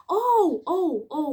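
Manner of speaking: sharp high startled cries. Retold or imitated. Realising the voice is his mother's, startled cry. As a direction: jolt up as it hits you.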